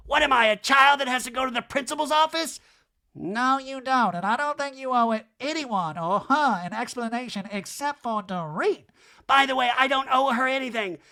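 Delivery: high, loud mock voice; hammed-up Kyle indignant; mock housewife. Recapping Kyle and Erica's exchange in a high mock voice.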